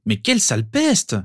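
high, exasperated outburst; high-pitched annoyed cry; exclaiming. A narrator's reading. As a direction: exclaim in indignation at a pesky sister. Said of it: "s'exclama son frère".

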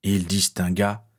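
usual speaking voice

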